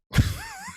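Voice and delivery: a sudden high shrieking laugh; burst of laughter. Real, not acted. Laughing at own joke.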